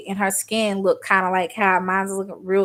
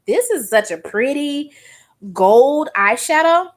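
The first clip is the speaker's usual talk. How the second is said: higher, louder, slowed on gold eyeshadow; naive delight; 6 semitones higher, 7 dB louder, slower than usual. Retold or imitated. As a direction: gush like an awestruck teen. Naive teen self admiring product.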